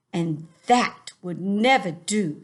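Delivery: put-on a big friendly giant in a funny English accent, drawn out. Teacher voicing the giant for her class, funny accent. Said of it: "give him a funny accent too".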